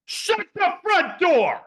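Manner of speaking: shrieked very high, loud and slow; a man stunned by the demo, screaming; flamboyant scream. Retold or imitated. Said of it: "And just screams".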